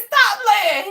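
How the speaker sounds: high dismissive; don't believe him; mock scold. Scolding boyfriend.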